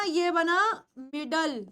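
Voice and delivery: slow, spelled-out ये बना मिडल; slow model word. Shown as a demonstration. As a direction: model ये बना मिडल slowly, ending on a plain ल. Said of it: "क्या बना?".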